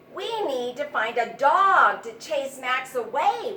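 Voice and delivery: loud eager mouse voice; bold mouse plan. A narrator's reading. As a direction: announce the plan with gusto. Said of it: "one said".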